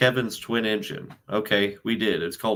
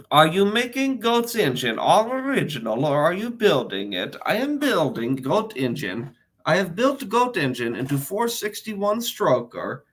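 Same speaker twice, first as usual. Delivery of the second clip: put-on Borat accent; 4 semitones higher than usual. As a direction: read it out in a lilting Borat accent. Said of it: "Borat accent".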